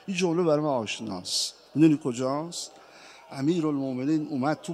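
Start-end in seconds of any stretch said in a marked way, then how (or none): none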